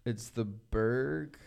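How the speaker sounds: slowly sounding out Berg; drawn-out, careful reading. Shown as a demonstration. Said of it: "How to pronounce?".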